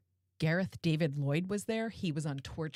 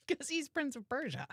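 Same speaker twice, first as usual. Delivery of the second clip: laughing through the words, pitched up; 11 semitones higher, 6 dB quieter than usual. Real, not acted. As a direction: giggle it out in delight. Laughing at famous co-guest.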